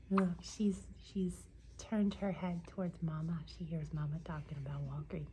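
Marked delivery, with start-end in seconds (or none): none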